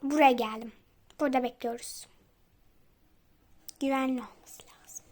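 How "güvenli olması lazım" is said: hushed, secretive whisper. Real, not acted. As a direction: whisper it like hiding from enemies. Sneaking in the game. (fısıltıyla)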